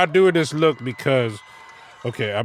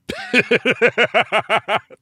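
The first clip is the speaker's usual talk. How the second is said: loud laugh; 10 semitones higher, 7 dB louder than usual. Real, not acted. Laughing at maniac.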